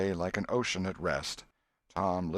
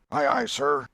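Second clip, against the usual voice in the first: louder, higher sailor's answer; eager loud reply; 7 semitones higher, 7 dB louder than usual. A narrator's reading. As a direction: answer the captain smartly. Crew answers the captain.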